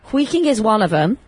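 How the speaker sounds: ordinary talk, nothing marked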